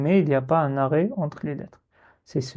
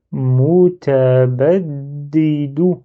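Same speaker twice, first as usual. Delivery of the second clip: mutabaddidu drawn out slowly and louder, all in one breath; a slow demo of fluent reading; slow and fluid; 5 dB louder, much slower than usual. Shown as a demonstration. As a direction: blend every syllable without stopping. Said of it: "Donc là, je dis mutabaddidu".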